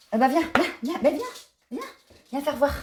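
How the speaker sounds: high, coaxing call to a cat; coaxing a pet. Real, not acted. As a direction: sweetly call the cat over. Calling the cat over. (appel câlin au chat)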